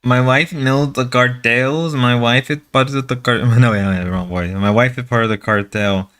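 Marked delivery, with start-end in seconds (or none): 0.0-3.4 low, put-on Mexican accent; mock Mexican accent. Husband warning about his wife's cartel, in a mock Mexican accent. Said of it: "I gotta stop doing the Mexican voice". 4.5-6.1 low, lightly put-on Mexican accent; mock Mexican accent. Husband claiming cartel ties, in a mock Mexican accent. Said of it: "I gotta stop doing the Mexican voice".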